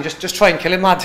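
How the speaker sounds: rough imitation of a Scouse accent; a loud Scouse accent, shouted from the corner. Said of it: "my worst Scouse accent".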